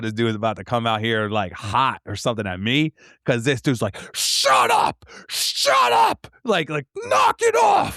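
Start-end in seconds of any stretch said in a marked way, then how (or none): 4.1-6.3 high shrill-free shouting at dogs, loud; mock angry yell. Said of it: "just yelling at his dog". 7.1-8.0 mock angry yell. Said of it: "just yelling at his dog".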